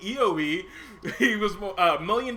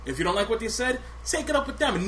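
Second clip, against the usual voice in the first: usual speaking voice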